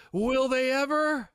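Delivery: put-on high, loud hopeful kid voice; a little boy hoping for candy; Milhouse voice. Milhouse asking the box man hopefully, high and loud. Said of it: "Milhouse goes".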